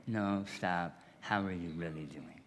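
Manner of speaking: Jesus asking how are you really doing, gently; sincere, soft question. Acted. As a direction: ask it like you truly want to know. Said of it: "Jesus asks sincerely".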